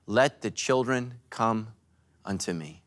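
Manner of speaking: slow, hushed reading of Jesus' words; gentle Bible quotation; solemn scripture quote. Retold or imitated. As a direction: let it fade reverently.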